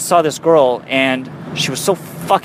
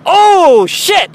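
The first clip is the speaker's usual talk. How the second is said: sudden high loud yelp of alarm; a jolt of real surprise, shouted high; startled shout; 14 semitones higher, 6 dB louder than usual. Real, not acted. Startled by road debris.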